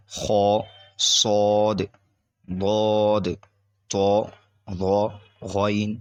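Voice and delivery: خا، صاد said thick and full-mouthed; teaching the heavy letters. Shown as a demonstration. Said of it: "پر یعنی موٹے پڑھے جاتے ہیں".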